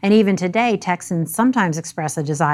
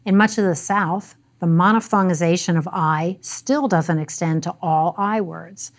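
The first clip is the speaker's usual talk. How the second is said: ordinary delivery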